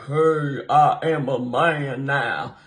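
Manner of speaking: a hint of mock deep man. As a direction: play a woman faking a man, slow. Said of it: "the woman's gonna be like".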